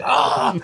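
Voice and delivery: high, loud mock howl of pain; retold pained cry. Telling friends about hitting his nose, pained cry. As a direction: reenact crying out in pain.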